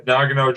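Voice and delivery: Nagano with the stress up front, NAH-guh-no; corrected pronunciation. Shown as a demonstration. Co-host confirming for the host, Nagano with first-syllable stress. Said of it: "I believe it is pronounced Nagano".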